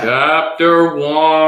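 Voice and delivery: slow, read-aloud voice; storybook reading. Pretend. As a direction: read it like a children's book.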